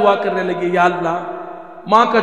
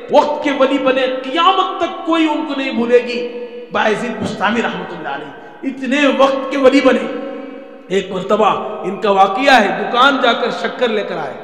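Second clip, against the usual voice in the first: usual speaking voice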